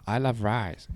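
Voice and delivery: short quote in a put-on Chinese accent; imitated Chinese accent. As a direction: lightly mimic a Chinese accent.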